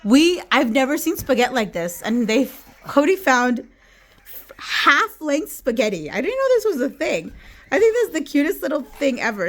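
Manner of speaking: high, loud and delighted; excited. Real, not acted. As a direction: gush over the cute find. Excited over half-length spaghetti.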